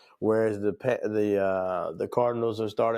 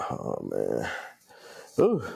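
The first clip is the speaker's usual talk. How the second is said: fighting off a sneeze; holding back a sneeze; 14 semitones lower, slower than usual. Real, not acted. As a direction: sneeze coming on. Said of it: "trying not to sneeze right now".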